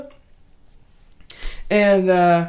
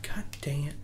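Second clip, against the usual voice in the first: softer, unvoiced frustrated whisper; whispered curse; 4 semitones lower, 13 dB quieter than usual. Disappointed boyfriend curses quietly, whispered. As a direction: mutter it under your breath. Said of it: "He's like".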